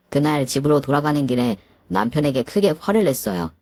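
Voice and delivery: ordinary delivery, nothing marked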